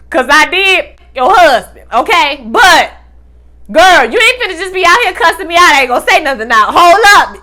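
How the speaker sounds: loud, warning clapback; a hint of Nova's anger. Nova warns the ex-wife, loud and heated. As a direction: push back hard but held in.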